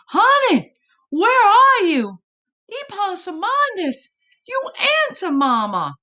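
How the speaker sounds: high, loud calling voice of a worried mama. Acted. Mama searching the swamp, calling high and loud. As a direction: call out for your lost child. Mother calling lost child.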